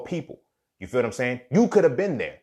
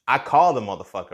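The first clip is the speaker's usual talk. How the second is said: usual speaking voice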